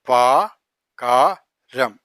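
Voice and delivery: പകരം stretched very slowly; drawn out. Shown as a demonstration. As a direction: hold each sound of പകരം. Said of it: "slow syllable demo".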